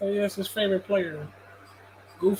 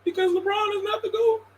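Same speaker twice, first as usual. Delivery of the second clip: mock crying fan; 8 semitones higher than usual. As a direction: whine like a heartbroken LeBron fan calling him a hater.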